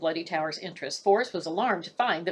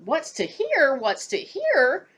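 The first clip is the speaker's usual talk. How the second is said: mock scoffing; 7 semitones higher, 4 dB louder, slower than usual. Narrator repeats the line.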